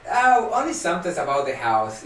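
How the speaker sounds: ordinary talk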